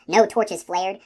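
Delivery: plain reading voice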